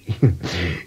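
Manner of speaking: chuckle. Acted. Old man recalls first love.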